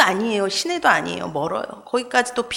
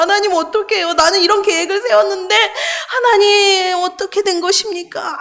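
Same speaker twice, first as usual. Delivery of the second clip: mock lament; 6 semitones higher than usual. Retold desperate prayer to God.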